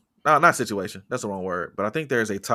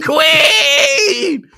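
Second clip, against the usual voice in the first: long, high fan shriek; mock adoring scream; 10 dB louder than usual. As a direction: stretch it into a hammed-up scream. Mock fan hyping up [name].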